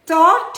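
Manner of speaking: thought punched high and loud; stress on thought. Real, not acted. Preacher to disciples, thought stressed high and loud. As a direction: lean hard on thought, rising. Preacher hammering key word.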